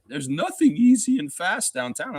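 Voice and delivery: high-pitched mocking mimicry; exaggerated imitation of a scoffer; mocking stranger impression. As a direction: parrot a naysayer in a high silly voice. Mimics the lady's words again.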